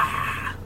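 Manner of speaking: hushed, voiceless imitation zombie scream; whisper-yelled "ah". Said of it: "Whisper yelling".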